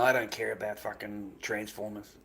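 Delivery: ordinary talk, nothing marked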